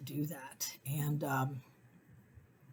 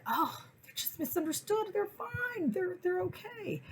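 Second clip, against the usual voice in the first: high airy gushing voice; mock naive dog lover; 4 dB quieter than usual. New dog lover gushing to herself, high and breezy. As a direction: gush about misunderstood pit bulls. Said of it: "it's like".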